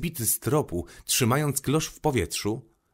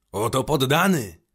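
louder proud royal cry, slightly raised; king's shout; 3 semitones higher, 4 dB louder than usual. A narrator's reading. King calling out on seeing the Little Prince, triumphant shout. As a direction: announce a new subject with a triumphant call. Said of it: "krzyknął król".

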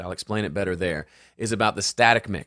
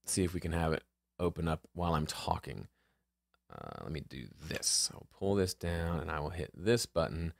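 distracted self-talk; 4 semitones lower, 9 dB quieter than usual. Real, not acted. Talking to himself while operating software.

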